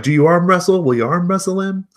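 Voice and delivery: high, loud goading question; excited crowd shout. Acted. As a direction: goad him from the crowd. Crowd eggs on arm wrestle.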